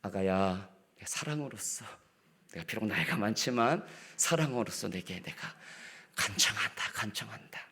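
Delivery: soft, gentle asking; tender plea. Retold or imitated. Elder gently asking a young one for water, tender plea. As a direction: ask sweetly, like coaxing a dear child. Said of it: "이렇게, 네, 사랑으로서".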